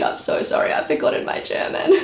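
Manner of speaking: breathy laugh through the apology; half-laughed sorry; sheepish laughing apology. Retold or imitated. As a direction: laugh it off, embarrassed. Apologising to German doctors in labour.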